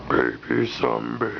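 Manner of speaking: put-on low, slow baby zombie groan. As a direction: drag it out like a little zombie. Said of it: "You say".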